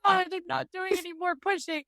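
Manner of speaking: high-pitched, hammed-up protest; dramatic. Mom reenacting refusing to push during labor, mock-dramatic. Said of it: "I was so dramatic".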